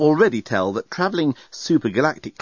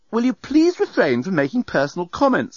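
cross; 9 semitones higher than usual. A narrator's reading. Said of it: "said Nettie rather crossly".

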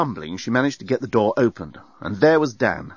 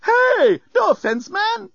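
high, loud, wounded bellbot protest; offended robot crying out; hurt robot voice; 4 dB louder, slower than usual. A narrator's reading. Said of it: "seemed genuinely hurt".

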